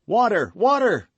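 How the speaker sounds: loud, high shout for water; urgent cry. Acted. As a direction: call out desperately. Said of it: "cried D'Artagnan".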